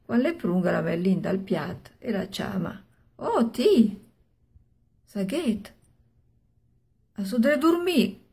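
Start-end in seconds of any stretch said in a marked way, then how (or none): 3.1-8.2 calling out. Acted. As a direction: call her awake from across the room. Husband calling wife to wake.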